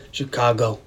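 put-on second try at a mobster accent, measured and low; put-on old gangster accent; improved Mickey Cohen. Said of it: "How was that? Better?".

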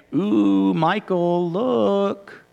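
lightly acted, drawn-out enticement; mock tempter. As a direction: dangle it teasingly, stretching each word. Voices God dangling a temptation.

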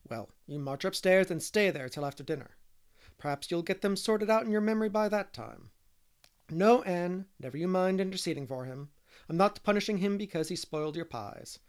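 strict guardian's telling-off; stern scolding. A narrator's reading. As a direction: lay down the law to a naughty child. Marilla sends Davy upstairs, scolding.

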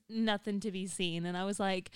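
ordinary talk, nothing marked

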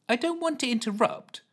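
high polite strained voice; polite Ellen. A narrator's reading. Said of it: "trying to sound as polite".

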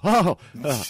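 pained reaction. Retold or imitated. Host recalling watching limbs snap, loud startled wince. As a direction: recoil as if seeing a limb snap. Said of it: "and you just go".

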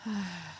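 soft sigh to compose oneself; calming breathy sigh; slow exhale. A narrator's reading. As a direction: let a breath out slowly. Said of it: "He let out a slow breath".

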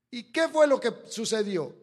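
loud, fired-up preaching; preaching shout. Real, not acted. Pastor preaching to the congregation, loud. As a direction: thunder it from the pulpit.

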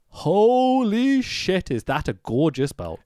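long, high drawn-out awe; stretched-out gasp of wonder; awed. Real, not acted. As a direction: marvel at the belt slowly. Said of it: "awe at belt".

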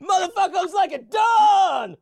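high, loud screamed line; hammed-up shout; mock scream. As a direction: yell it like a cook losing it. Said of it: "You gotta stop screaming like that".

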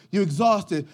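normal talking voice

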